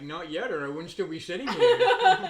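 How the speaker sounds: loud, slow, laughing sarcastic comeback; laughing retort. Retold or imitated. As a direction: laugh through the snarky reply. Retold sarcastic reply, laughing.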